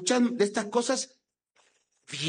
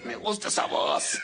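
put-on hoarse, voiceless rasp; ronco. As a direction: admire your own hoarse voice. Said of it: "me puse todo ronco". (voz ronca fingida, casi sin sonido)

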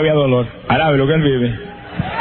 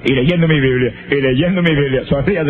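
normal talking voice

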